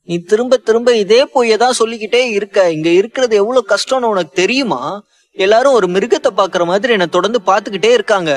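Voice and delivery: high, loud, desperate wailing plea; wailing and crying. A narrator's reading. Lilly wailing to her sister about wanting to die, crying loudly. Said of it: "கதறி அழுதாள்".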